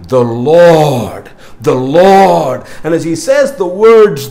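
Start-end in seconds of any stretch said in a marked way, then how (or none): 0.1-2.6 slow, weighty The Lord, stressed each time; solemn declaration. Acted. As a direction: proclaim The Lord like a herald. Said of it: "He begins by saying".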